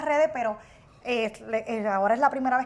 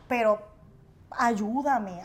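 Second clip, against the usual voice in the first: usual speaking voice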